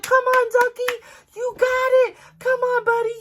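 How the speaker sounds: high, loud cheering on the duckling; root for him like a sports fan. Retold or imitated. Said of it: "I am audibly cheering".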